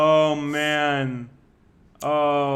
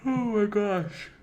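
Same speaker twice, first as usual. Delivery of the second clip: slow, yawning words; 6 semitones higher, much slower than usual. Real, not acted. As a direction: talk through a yawn. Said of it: "I don't know why I'm yawning".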